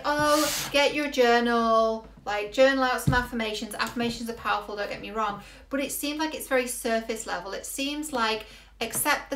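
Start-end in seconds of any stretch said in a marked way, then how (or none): 0.0-3.8 gushing mock advice, higher and louder; mock fluffy self-help. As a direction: parody a fluffy self-help coach, drawing out the opening. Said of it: "taught in a very fluffy way".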